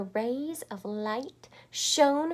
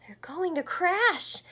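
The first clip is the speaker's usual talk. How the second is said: gasped; 9 semitones higher, 5 dB louder than usual. Acted.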